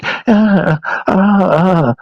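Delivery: high, hammed-up mock moans; mock moaning. Mocking a romance scene for the podcast, high mock moans. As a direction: parody breathy movie-sex moaning over music.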